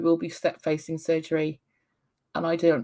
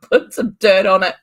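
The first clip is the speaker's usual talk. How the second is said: "Put some dirt on it" said louder, brisk and dismissive; brisk mum; 8 dB louder than usual. Retold or imitated. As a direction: tell him off loudly. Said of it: "my mummy voice is just like".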